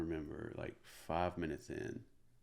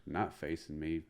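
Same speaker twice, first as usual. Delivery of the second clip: normal talking voice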